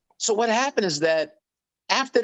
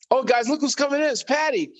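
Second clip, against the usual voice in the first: tag; 8 semitones higher than usual. Retold or imitated. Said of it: "I'm like".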